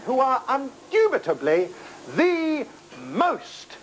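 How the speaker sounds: slow, rising build to the most; drawn-out build. Acted. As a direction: stretch it out for a big finish. Grand build-up praising the band.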